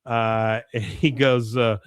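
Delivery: usual speaking voice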